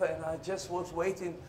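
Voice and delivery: ordinary delivery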